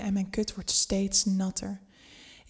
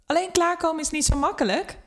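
higher, raised call back up; higher-voiced call back to the boss; 8 semitones higher than usual. A narrator's reading. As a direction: call it back across the room. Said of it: "roep ik terug".

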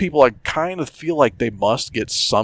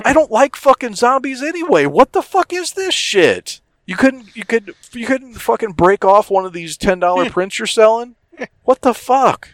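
much higher, ranting disbelief; incredulous outrage; 10 semitones higher than usual. Retold or imitated. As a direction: vent at the worthless print. Retold outrage at the zombie print.

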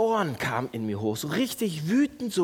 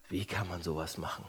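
hushed self-righteous murmur; 11 semitones lower, 13 dB quieter than usual. Shown as a demonstration. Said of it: "Und wisst ihr was?".

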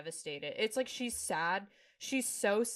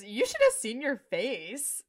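teasing; 5 semitones higher, 7 dB louder, slower than usual. Retold or imitated. Bonnie teases Carl.

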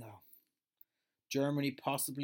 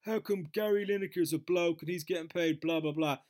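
higher, louder whiny protest; mock complainers; 6 semitones higher, 6 dB louder than usual. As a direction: whine it like an outraged crowd. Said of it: "everyone kicked off going".

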